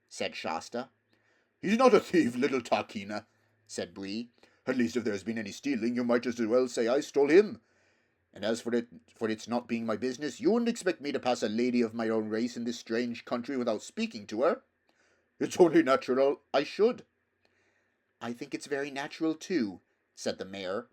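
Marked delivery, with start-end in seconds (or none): 1.6-17.0 proud horse. Acted. Bree defending Shasta. 18.2-19.8 gentle mare. Acted. Mare speaks softly.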